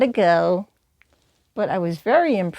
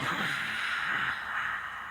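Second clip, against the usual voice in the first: dragon breath; 12 dB quieter than usual. Shown as a demonstration.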